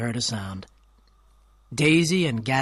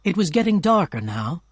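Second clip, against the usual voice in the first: ordinary delivery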